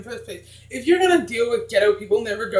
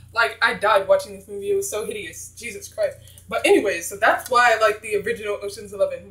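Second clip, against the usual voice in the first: ordinary delivery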